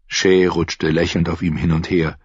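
ordinary delivery, nothing marked

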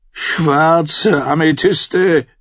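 very slow, high tipsy swearing; drunken oath. Acted. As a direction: drag out each word as a drunk swears. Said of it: "schwor er". (sehr langsam, hoch, betrunken beschworen)

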